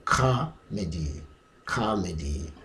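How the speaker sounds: "comedy" slowly as KAH-medy, falling low; "ka" pronunciation. Shown as a demonstration. Teacher showing British pronunciation, slow open "kah". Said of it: "pronounced as the sound ka".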